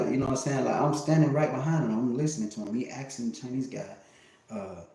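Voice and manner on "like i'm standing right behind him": normal talking voice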